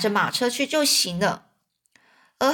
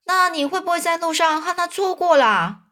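fussing aunt; 7 semitones higher than usual. A narrator's reading. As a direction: fret at him like a worried aunt. 阿姨追问老先生. (阿姨着急地追问，声音尖高)

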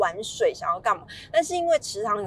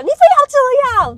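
loud, high-pitched outburst; mimicked frantic parent shouting; out-of-control yelling; 11 dB louder than usual. Shown as a demonstration. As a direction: yell like a parent losing it in public. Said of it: "失控的大叫".

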